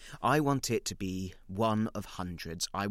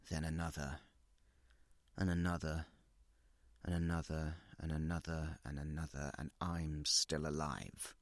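each repeat softer, trailing off; fading to hush; 4 semitones lower, 7 dB quieter than usual. Acted. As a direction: let it sink lower and quieter. Snapping traps on own hand.

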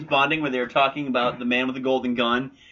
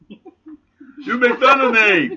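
laughing mock wail; 6 semitones higher, 4 dB louder, much slower than usual. Voicing the kid in movie.

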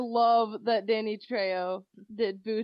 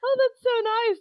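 high, loud gushing voice; mock parents; 14 semitones higher, 8 dB louder than usual. Dora's parents hearing her plan: high, loud gushing. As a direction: gush it out high and sweet. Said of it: "they're like".

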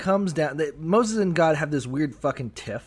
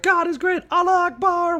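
much higher, ecstatic chanted praise; mock praise; 4 dB louder than usual. Prophets singing praise to God, high ecstatic cry. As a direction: cry it out like a rapt worshipper.

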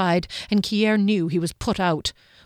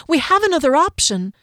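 high, louder earnest appeal; earnest voice climbing higher; 9 semitones higher, 6 dB louder than usual. A narrator's reading. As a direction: urge it hopefully, ending in a question. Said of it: "Fennel said earnestly".

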